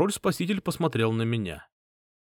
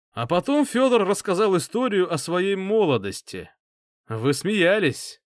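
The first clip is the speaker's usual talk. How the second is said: smooth, higher, coaxing voice; persuasive king; 7 semitones higher, 3 dB louder than usual. A narrator's reading. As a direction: draw them in warmly. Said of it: "вкрадчивый и приятный".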